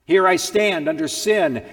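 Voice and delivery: a sinner crying out his guilt before God; loud confession. Acted. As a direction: cry it out, loud and high.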